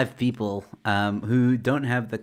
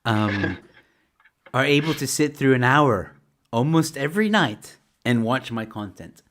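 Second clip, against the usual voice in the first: normal talking voice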